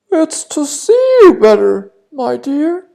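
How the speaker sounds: very high, loud fake granny voice; sugary fake grandma; wolf as grandma. (the disguised wolf answering the girl, fake granny voice)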